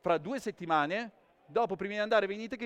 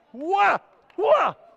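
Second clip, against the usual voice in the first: short, high, loud shouts; explosive high yelps; trick exclamations; 11 semitones higher, 11 dB louder than usual. Real, not acted. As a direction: cry out sharply on each move of the trick. Juggler's cries during triple trick.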